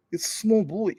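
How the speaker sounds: high-pitched mock Russian accent; short burst of put-on Russian accent. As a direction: slip back into the Russian voice. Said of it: "Russian voice".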